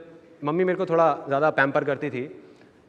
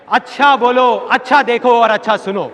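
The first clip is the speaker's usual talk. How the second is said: emphatic call; 4 semitones higher, 8 dB louder than usual. Shown as a demonstration. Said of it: "emphatic contrast to bad".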